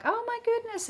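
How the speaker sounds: high, thrilled "oh my goodness"; mock-surprised recipient; delighted gasp. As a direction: play the friend opening a lovely card. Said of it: "they'll be like".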